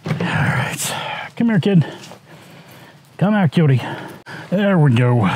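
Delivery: high coaxing pet voice; talking to a dog. Real, not acted. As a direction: coax the dog out sweetly.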